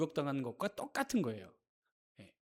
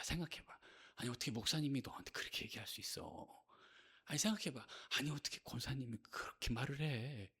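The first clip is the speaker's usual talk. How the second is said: soft, low, half-whispered needling; insinuating devil; 5 semitones lower, 10 dB quieter than usual. Acted. Devil turning a believer against the pastor, softly insinuating. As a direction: murmur it into the ear to stir up hurt, in acted imitation. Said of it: "마귀가 똑같이 합니다".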